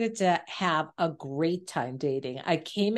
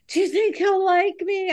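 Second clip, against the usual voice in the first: high, louder mock-anxious plea; imitating insecure self; 11 semitones higher, 5 dB louder than usual. Retelling tearful calls to her sister, mock insecure. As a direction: act out a needy, high-pitched worry. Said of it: "I cried all the time. I would call my sister".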